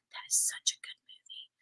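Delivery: breathy whispered aside, no voice at all. Real, not acted. Whispering an aside to the viewers about the film. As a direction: lean in and whisper it.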